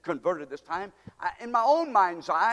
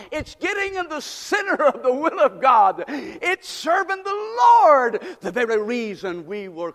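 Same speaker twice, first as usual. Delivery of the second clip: loud, higher preaching shout; full-force preaching; 5 semitones higher, 6 dB louder than usual. Acted. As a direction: drive it home to the congregation. Preaching climax to congregation.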